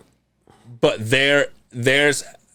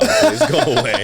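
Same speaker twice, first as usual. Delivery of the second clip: high, laughing mock plea; high-pitched joking sign message to door knockers. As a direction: pitch it up for the punchline.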